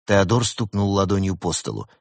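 ordinary delivery

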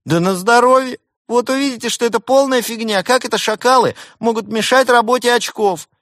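very high, loud squawking; huffy protest at the top of the range; indignant jackal pilot. A narrator's reading. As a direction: scoff in a squeaky outraged voice. Affronted pilot scoffs at accusation.

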